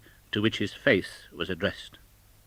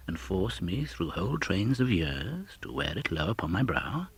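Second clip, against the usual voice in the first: reproachful spirit; 4 semitones lower, 7 dB quieter than usual. Acted. Spirit rebukes Scrooge.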